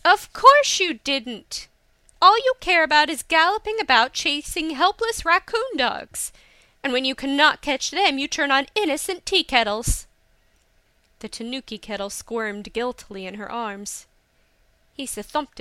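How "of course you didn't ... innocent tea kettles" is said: high, loud, indignant scolding; exasperated outburst with raised voice; furious tirade. A narrator's reading. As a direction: cut in and tell him off in full flow. Hisa's tirade cuts Akio off.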